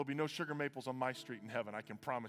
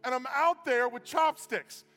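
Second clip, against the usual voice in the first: preaching shout; 9 semitones higher, 9 dB louder than usual. Real, not acted.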